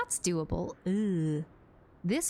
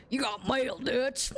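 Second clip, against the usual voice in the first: a hint of a gruff outlaw yelling; mock Dutch gruff. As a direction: shout it like an outlaw. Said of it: "I got a plan, Dutch".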